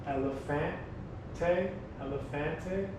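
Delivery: elefante drawn out, Spanish vowels, e-le-FAN-te; slow Spanish. Shown as a demonstration. Teacher slowly models elefante for the class. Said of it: "in Spanish".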